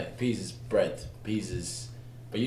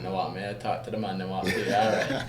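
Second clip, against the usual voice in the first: lightly playful Jamaican patois; Jamaican accent. Acted. Actor showing slang's roots to host, Jamaican accent. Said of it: "what it would be if you're Jamaican".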